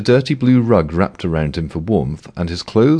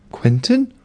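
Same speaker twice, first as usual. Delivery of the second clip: plain reading voice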